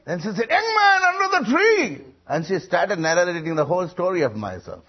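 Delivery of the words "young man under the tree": high, loud shouted summons; piercing acted call to someone far away; preacher calling out. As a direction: imitate a preacher shouting across a field. Same call repeated in story.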